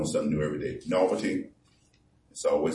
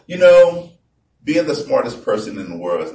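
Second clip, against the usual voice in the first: preaching at full force; 8 semitones higher, 9 dB louder than usual. Acted. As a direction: boom the verses out to the congregation. Preaching about Solomon's frustration.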